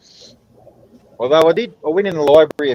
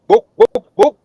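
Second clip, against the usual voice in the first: chicken clucking; 12 semitones higher, 7 dB louder, slower than usual. Retold or imitated. Chicken asks again for book.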